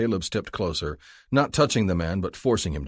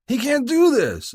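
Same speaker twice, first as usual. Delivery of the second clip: much higher, loud, slow protest; 8 dB louder, slower than usual. A narrator's reading. Drunk protesting being taken to the cruiser. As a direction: object at full volume. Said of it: "the drunk protested".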